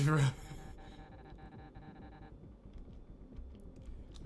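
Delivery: a frustrated laugh. Real, not acted. Gamer laughing at a boss he can't beat. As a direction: laugh it off in exasperation.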